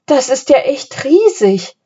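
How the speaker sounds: high, wide-eyed wonder; marvel, pitch rising well up; amazed. A narrator's reading. Philipp staring from the hilltop, astonished. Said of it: "staunte er".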